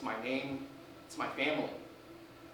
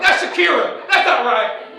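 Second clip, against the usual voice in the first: mock exclaim; 9 semitones higher, 15 dB louder than usual. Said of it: "I was like".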